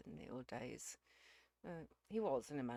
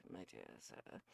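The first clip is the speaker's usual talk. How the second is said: low father voice; 6 dB quieter, slower than usual. Acted.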